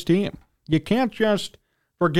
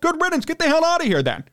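indignant dismissal; 10 semitones higher than usual. Real, not acted. Angry rant at player.

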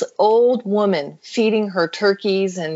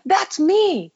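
retold surprised realization; 10 semitones higher, much slower than usual. Old woman recognizes herself in picture.

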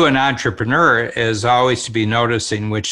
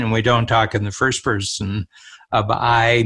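ordinary talk, nothing marked

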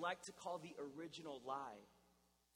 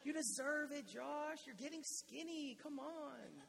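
coaxing tempter voice; 8 semitones higher, slower than usual. Retold or imitated. Pastor mimicking his inner voice talking him into food, high and wheedling. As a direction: sweet-talk yourself into a treat. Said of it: "a lie I love to tell myself".

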